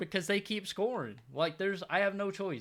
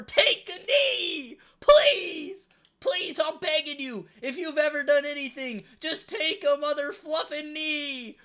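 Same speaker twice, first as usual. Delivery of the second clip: desperate begging shout; 8 semitones higher, 7 dB louder, slower than usual. Real, not acted. Begging opponent to kneel.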